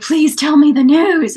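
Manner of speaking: pleading Juliet. Retold or imitated. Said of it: "Juliet's like".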